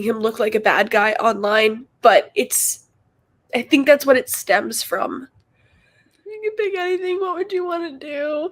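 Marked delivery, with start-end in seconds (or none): 6.3-8.5 choked sob rising into a cry; crying out, higher pitch. Real, not acted. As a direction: voice raised high, tearful. Crying again, voice high.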